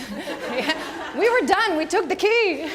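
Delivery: high, loud words through real laughter; can't stop laughing. Real, not acted. Laughing fit mid-talk.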